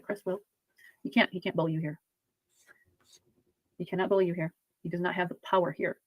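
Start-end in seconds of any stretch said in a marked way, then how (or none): 0.0-0.4 put-on much higher, softer child's voice; spirit's squeaky reply. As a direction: answer like a small frightened child. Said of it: "He said".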